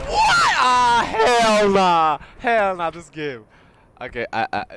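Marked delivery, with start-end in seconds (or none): 0.1-3.4 loud, high, drawn-out howl of outrage; real gamer rage; raging shout. Real, not acted. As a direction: bellow at the game, easing off at the end. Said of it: "I'm shouting too much".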